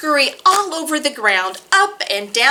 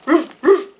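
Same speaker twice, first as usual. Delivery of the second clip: light barking roof roof; dog bark; 4 semitones higher, 4 dB louder than usual. A narrator's reading. Dog calling the squirrel, light playful bark. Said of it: "said the dog".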